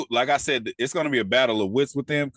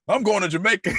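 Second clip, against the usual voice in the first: higher, hammed-up drunk friend announcing he's leaving; drunk buddy quote; 9 semitones higher, 3 dB louder than usual. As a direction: play the drunk guy bailing out, pitched up. Voicing drunk Jerome leaving.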